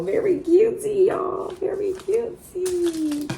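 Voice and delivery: high, drawn-out sing-song cutesy; cutesy gush. Real, not acted. Vlogger coos to viewers about the cute letter. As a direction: coo it like something adorable.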